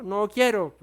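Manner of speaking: mock childish whine; spoiled child's tantrum. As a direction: refuse like a pouting toddler. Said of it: "con su pataleta o su consentimiento".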